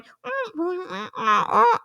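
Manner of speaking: high squeaky guinea pig noises. Retold or imitated. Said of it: "'cause he's going".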